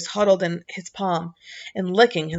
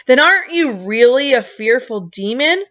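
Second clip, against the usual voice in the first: desperate; 4 semitones higher, 6 dB louder than usual. A narrator's reading. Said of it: "Milo asked desperately".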